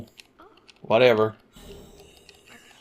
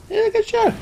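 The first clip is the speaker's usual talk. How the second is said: squeaky aside. Real, not acted. Sudden squeak.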